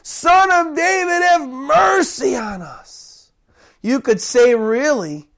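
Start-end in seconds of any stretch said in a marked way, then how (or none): 0.0-3.3 high, long-drawn plea; yelling even louder. Acted. Shushed blind men yelling to Jesus, desperate cry. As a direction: stretch the cry out to carry over the crowd. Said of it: "They gotta yell even louder".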